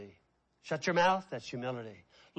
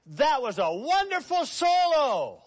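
gushing compliment; 11 semitones higher, 8 dB louder than usual. Retold or imitated. Acted fan praising a soloist.

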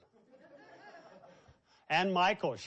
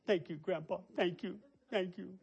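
higher, small sobbing thanks; imitated tearful child; 3 semitones higher than usual. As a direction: mimic a crying little girl's gratitude. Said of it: "been crying".

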